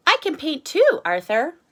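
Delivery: put-on high, loud eager kid voice; Max eager. Max asks Arthur to paint too, high eager voice. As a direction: pipe up keen to join in. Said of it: "says Max".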